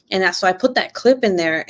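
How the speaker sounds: usual speaking voice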